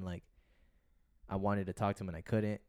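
ordinary talk

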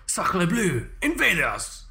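hammed-up French exclaim; french chef. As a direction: play a startled French cook. Sudden French exclamation bit.

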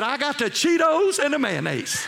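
high, loud mock holler; triumphant payback shout; gloating taunt. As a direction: crow it at the queasy crew. Taunts the sick men back.

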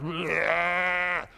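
a quick mock blubbering bleat; mock crying wail. Preacher mimicking the boy who always cried, mock wail. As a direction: imitate a whiny kid's cry in one burst. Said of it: "like the cry, like".

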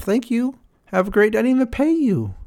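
hammed-up sing-song brush-off; mock breezy user. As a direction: toss it off like a smug employer. Voicing dismissive user.

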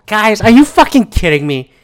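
loud, high exasperated outburst; can't believe what you're seeing; loud, incredulous complaint; exasperated disbelief. Retold or imitated. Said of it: "I go".

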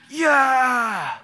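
loud, high, long yell; triumphant fan shout. Acted. Fan whose team wins the match, loud triumphant yell. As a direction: cheer wildly for the win.

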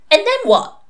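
put-on high, loud little girl's demand. A narrator's reading. Six-year-old suspect questioning her torturer, demanding. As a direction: challenge them like a small child. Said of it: "demanded the suspect".

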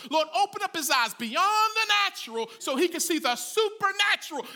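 preaching-style prayer building in force; fervent prayer. Acted. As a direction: pray it out with rising fervor. Preacher voices Elisha's prayer.

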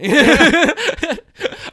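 high burst of laughter; loud laugh. Real, not acted. Laughs at his own verdict.

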